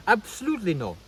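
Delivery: put-on Sadhguru accent. Vlogger quoting the guru's verdict on a slap, imitated Sadhguru accent. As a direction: mimic the guru's Indian accent on a firm refusal. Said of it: "That's how he said, okay?".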